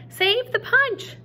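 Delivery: high, loud, slow yell. Acted. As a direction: shout it over the rain to save the food. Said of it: "Gloria Dump yelled to me".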